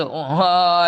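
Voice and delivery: usual speaking voice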